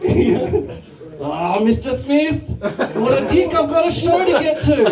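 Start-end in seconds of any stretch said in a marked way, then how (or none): 1.2-4.8 high, drawn-out mock complaint; mock aggrieved student. Mimics student complaining about teacher.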